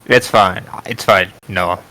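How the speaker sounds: usual speaking voice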